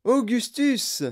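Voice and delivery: high, drawn-out delighted cry; effusive high-pitched host greeting; Wonka exclaiming. A narrator's reading. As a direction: cry out the name slowly in greeting. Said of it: "s'écria monsieur Wonka".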